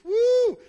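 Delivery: high, loud woo shout; a preacher's rising cheer; excited whoop. Real, not acted. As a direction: let out a joyful whoop.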